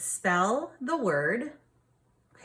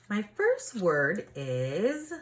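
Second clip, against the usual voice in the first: normal talking voice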